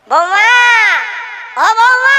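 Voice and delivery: loud, high call across the house. Acted. Preacher calling the daughter-in-law, loud high call. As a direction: shout for the daughter-in-law to come and listen.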